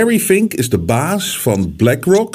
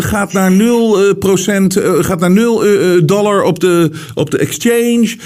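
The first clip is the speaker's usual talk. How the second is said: imitated doom-monger, higher pitched; doom and gloom; 6 semitones higher than usual. Host retelling investor's TV interview on the crash, doom and gloom. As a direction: retell a TV prophecy of total collapse. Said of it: "Hij was doom en gloom".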